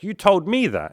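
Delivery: high, loud protesting woman; indignant girl voice. Acted. Coach voicing the woman blaming the guy, high and loud. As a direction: fire it back as the girl. Woman insists in comic roleplay.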